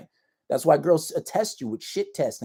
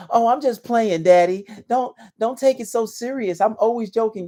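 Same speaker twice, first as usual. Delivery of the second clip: a hint of a sweet-talking girl, raised higher; sweet teasing girl voice; 8 semitones higher, 5 dB louder than usual. Girl sweet-talking him after an insult, lightly mocking. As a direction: coo it like you're teasing your man. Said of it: "They'll try to get sweet".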